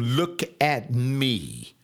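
very slow, stress on "at", falling end; slow firm command. Retold or imitated. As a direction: tell them "Look at me" with authority, drawn out. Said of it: "I tell 'em".

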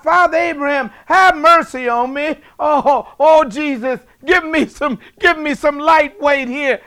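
high, loud mock plea for mercy; crying out. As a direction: cry out like a sinner begging from hell, hammed-up. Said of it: "Now you crying out".

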